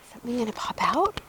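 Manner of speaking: quick breathless whisper. Real, not acted. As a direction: whisper it fast, no voice. Reader's excited aside, unvoiced.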